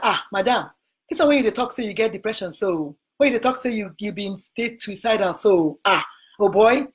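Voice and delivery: lightly voiced Nigerian Pidgin warning; a hint of Nigerian Pidgin, brisk in places. Imitating the man warning her about the Texas board, in light Nigerian Pidgin.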